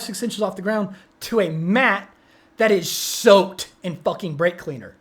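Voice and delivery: ordinary talk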